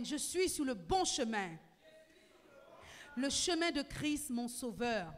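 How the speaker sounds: Je suis sur le bon chemin declared loud and full; loud preaching declaration; loud proclamation. Shown as a demonstration. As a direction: proclaim it loudly to the whole church. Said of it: "Tu vas dire avec moi".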